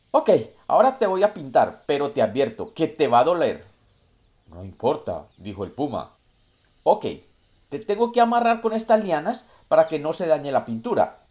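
high, loud, scheming monkey; sly monkey voice. Acted. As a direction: warn him with a sly grin. Monkey prepares to paint the puma.

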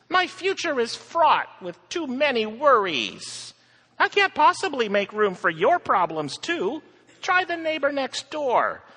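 high, fretting worried voice; anxious fretful woman. Acted. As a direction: spiral into worry, shrill. Miss Fear frets aloud.

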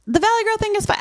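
high, bright valley girl imitation.

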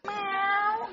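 high, mock cat meow. Shown as a demonstration. Preacher showing the church a cat, high mock meow.

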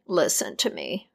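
a hushed ominous caution; low warning. A narrator's reading. Death quietly warning her goddaughter, low and grave.

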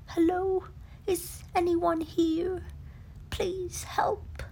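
a hidden bear calling out; pleading Pooh. Acted. Pooh under the leaves calls for help. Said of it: "said Pooh".

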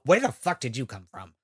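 usual speaking voice

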